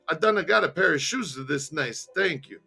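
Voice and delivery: put-on delighted thanks in a thick Italian accent; joyful Italian accent. Jimmy admires the gifted shoes, joyful Italian accent. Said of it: "with a joyful, joyful voice".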